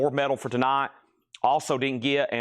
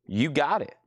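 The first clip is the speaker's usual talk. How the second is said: normal talking voice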